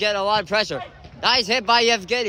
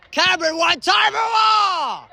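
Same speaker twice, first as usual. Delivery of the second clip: excited shout; 8 semitones higher than usual. Real, not acted. Commentator calling a one-timer, excited high shout. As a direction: shout the shot and react. Shot on goal live.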